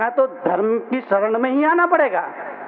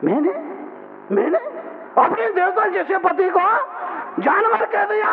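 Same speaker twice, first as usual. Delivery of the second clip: remorseful outcry; 9 semitones higher than usual. Acted. Wife regrets calling husband animal.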